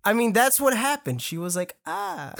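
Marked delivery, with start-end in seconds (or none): 1.9-2.3 very soft, high-pitched little shriek; toned-down, half-hearted scream; tiny mock scream. Toned-down replay after loudness complaint.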